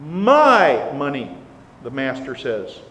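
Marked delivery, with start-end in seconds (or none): 0.1-1.4 my money, with my loud and drawn out; stress on my. Acted. Master demanding his money back, stressing my. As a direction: lean hard on the word my. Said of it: "I emphasize that word "my".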